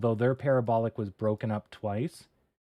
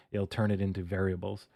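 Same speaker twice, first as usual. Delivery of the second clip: ordinary talk, nothing marked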